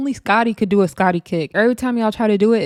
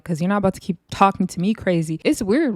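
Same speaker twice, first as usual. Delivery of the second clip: ordinary delivery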